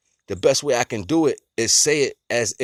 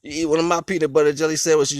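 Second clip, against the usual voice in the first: ordinary talk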